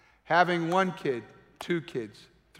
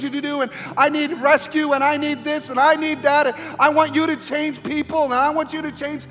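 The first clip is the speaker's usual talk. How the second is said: much higher and louder, driving, almost shouting the list; preaching at full force; 9 semitones higher, 9 dB louder than usual. Acted. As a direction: pile up the demands in a rising preacher's roar. Preaching climax, imitates dumping requests.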